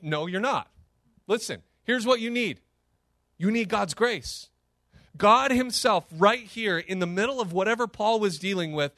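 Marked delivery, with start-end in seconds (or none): none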